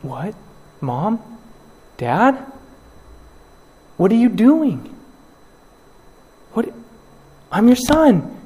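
high, loud, frightened cries; panicked pleading. Pretend. Disowned son begging his parents, high panicked cries. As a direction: beg for help in disbelief.

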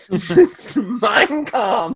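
slow, laughing admission. Real, not acted. Game master admitting the joke guess was right, laughing. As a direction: give in to the laugh as you confirm it.

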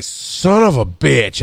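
slow, resentful mutter; muttered mock defeat; grumbled curse. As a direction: drag it out under your breath. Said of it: "And I'm like".

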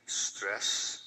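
"stress" hit loud and hard; stressed word. Shown as a demonstration. Quoting a journal, leaning on "stress" with extra force. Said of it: "and I stress the word stress here".

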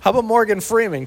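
ordinary talk, nothing marked

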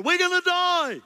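high, loud, frantic wail; panicked cry. Acted. Israelites wailing to Moses at the sea, panicked. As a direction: cry out as if about to drown.

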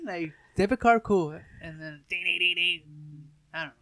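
usual speaking voice

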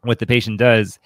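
ordinary delivery

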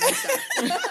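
high squeal of a laugh. Real, not acted. Teased about her night, done and cracking up high. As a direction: break into giggles on the words.

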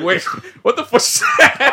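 high-pitched, breaking into laughter; laughing disbelief. Retold or imitated. Viewer retells his shock at the movie to friends, laughing in disbelief. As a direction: crack up while acting out your bafflement.

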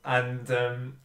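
normal talking voice

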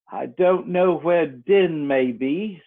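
slow, drawn-out Mock Turtle. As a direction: drag the words out like a weary old turtle. Mock Turtle speaks, slowly.

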